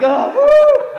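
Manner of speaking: high, loud mock-ecstatic woo; whoop of fake climax. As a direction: whoop it up, faking it.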